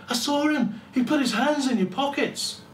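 high, loud insistent telling; urgent accusation. Acted. Young thief telling the robbed man he saw the youth, urgent loud accusation. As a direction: convince the victim fast.

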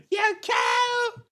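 very high-pitched mock yell; mimicked outraged shout. Viewer parroting the Scot's insult to Alexa, high mock shout. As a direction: shriek it in imitation.

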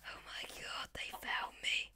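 hushed mock-fearful whisper; playful frightened whisper, they found me; scared whisper. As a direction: whisper as if hiding from the mob. Bobs have found him.

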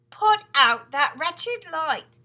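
very loud, slow, high shout. Acted. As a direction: hurl it at the ceiling. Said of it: "he shouted".

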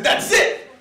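sudden angry dad voice; fed-up father. Acted. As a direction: snap like a dad who has had enough. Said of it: "Poppy said".

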